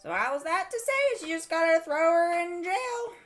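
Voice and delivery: high, singsong needling voice; put-on character voice. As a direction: lilt it high to needle the listener. Player's put-on voice, told to stop talking.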